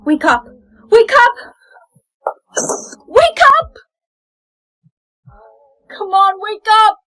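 building to a desperate scream; frantic shouting. Acted. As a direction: scream at them to wake. Desperately waking friend.

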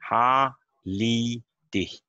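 "Ha, li, dicht" split into three beats; syllable by syllable. Shown as a demonstration. Teacher breaks the verb into syllables for students. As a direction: tap out each syllable of "Ha, li, dicht". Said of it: "three syllable".

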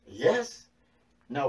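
usual speaking voice

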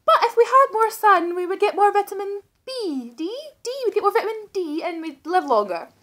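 bright high hopeful. Pretend. Sudden hopeful high pitch.

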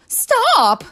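piercingly high, very loud command; shouting. A narrator's reading. Teacher to apples jumping on her, shouting. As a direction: shout it to halt an attack. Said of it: "she shouted".

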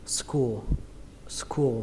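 "school" for skull, vowel rounded to U, "sku". Shown as a demonstration. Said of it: "các bạn có thể đọc âm này âm u cũng được". (đọc thành "school" với âm u)

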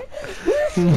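squealing laugh; high burst of laughter. Real, not acted. Laughing at silly name.

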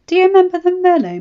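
high, loud, quick leading question; lightly voiced Varys, sly. A narrator's reading. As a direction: needle him with a knowing hint.

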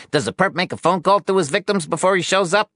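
high, rapid-fire reporter's question; prying newshound, quick and high-pitched; needling reporter. Acted.

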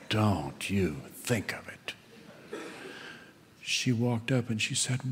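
ordinary delivery

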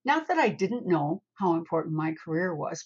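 ordinary talk, nothing marked

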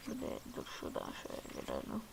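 quick hushed whisper; whispered plan while sneaking; fast whisper. Real, not acted. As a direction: slip the words out fast and unvoiced. Sneaking into a spot.

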